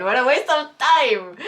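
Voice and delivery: loud, high, drawn-out complaint; exasperated outburst. Real, not acted. Reviewer done with a disliked book, exasperated outburst. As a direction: burst out with it, slow and exasperated.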